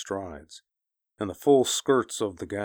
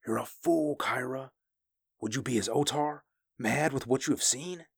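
higher-pitched, understated harsh rebuke; a touch of harsh reproach, voice raised in pitch; 7 semitones higher than usual. A narrator's reading. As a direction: chide them harshly, pitch lifted. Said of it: "he said harshly".

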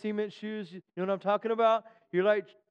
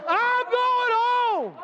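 much higher, very slow drawn-out cry; preaching at full force; 9 semitones higher, much slower than usual. Real, not acted. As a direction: soar on every word. Refrain climax.

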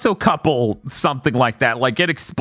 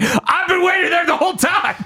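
mock villain shout; 11 semitones higher than usual. The villain bursts from the lake, a high mock shout. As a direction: shout it like a villain springing from the water. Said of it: "It's just like".

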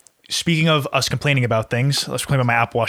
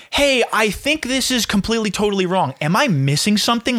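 higher, fake-polite puzzled tone; mock the passive-aggressive email, pitched up; mock confused question; 8 semitones higher than usual. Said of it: "pose it as a confused question".

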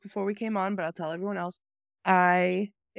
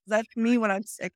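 ordinary delivery

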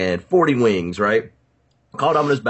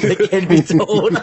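laughing echo; 11 semitones higher, 7 dB louder, slower than usual. Real, not acted. Laughing echo of his hedge.

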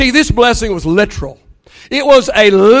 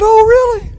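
mock surprise; 12 semitones higher than usual. Grandpa reacts to child's tease.

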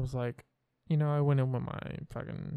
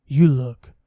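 imitating friend; 3 semitones lower, 6 dB louder, slower than usual. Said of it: "And she was like".

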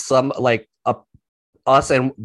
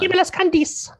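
put-on greedy kid voice. Greedy kids hunting candy.

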